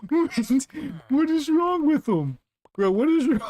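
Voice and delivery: high, laughing what-is-wrong-with-him; laughing disbelief. Real, not acted. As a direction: crack up in high disbelief at the villager. Watching villager go crazy.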